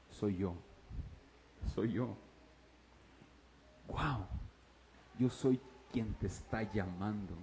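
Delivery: gently calm a frightened man; tender voice of the Lord. Acted. Jesús le habla a Pablo. (voz del Señor, baja y tierna)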